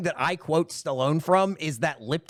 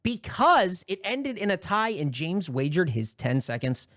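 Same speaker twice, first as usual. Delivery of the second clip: ordinary talk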